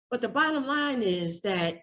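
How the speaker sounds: ordinary talk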